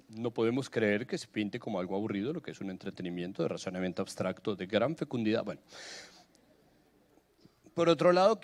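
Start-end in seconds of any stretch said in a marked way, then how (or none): 0.1-5.4 lower, formal mock complaint; pompous letter voice. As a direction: read it like an offended official letter. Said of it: "una carta de la Asociación de Defensores del Cubo Rubik". (voz de carta oficial indignada)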